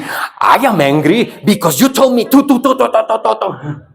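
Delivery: high-pitched acted tirade running into rattled syllables; mock anger. Shown as a demonstration. Teacher leading the group in one voice, mock angry. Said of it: "Should we try one more time?".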